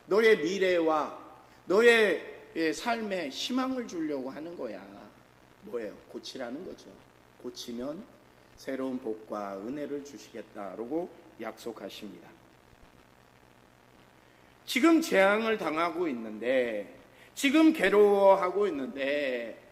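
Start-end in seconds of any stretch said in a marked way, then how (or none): 0.1-4.9 느리고 크게 외치는 "미래와"; 한 마디씩 외쳐 힘주기. Acted. 14.7-19.6 크고 느리게 외치며 강조; 고난을 힘주어 외치듯; 외치는 설교. Acted.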